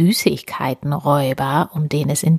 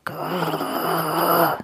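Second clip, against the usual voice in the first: soft playful growl; mock growl; dog growl; 4 dB quieter than usual. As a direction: growl quietly like a small dog. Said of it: "knurrt Max".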